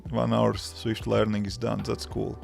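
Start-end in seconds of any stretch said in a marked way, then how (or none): none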